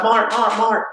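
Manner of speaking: mock urgent call to wake him up.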